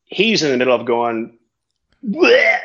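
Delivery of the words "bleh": high, loud mock retch; loud bleh of vomiting; vomit noise. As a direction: imitate a guy throwing up. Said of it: "he's starting to vomit all over me".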